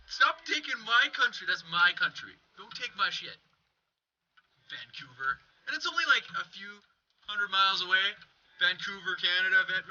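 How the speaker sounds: ordinary talk, nothing marked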